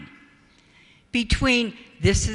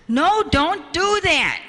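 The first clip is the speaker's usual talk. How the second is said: put-on higher, louder, slow stern no to the child; firm no; 8 semitones higher, 4 dB louder, slower than usual. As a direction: stop the child firmly. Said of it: "Grandpa says".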